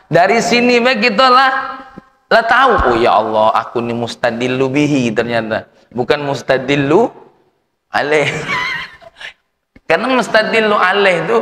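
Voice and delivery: high, loud sermon voice; fiery preaching. Acted. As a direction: drive the point home to the hall. Preacher rises to full force.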